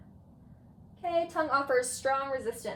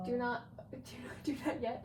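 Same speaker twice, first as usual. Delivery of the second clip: laughing; 8 dB quieter than usual. Real, not acted. Laughing while telling patient wait.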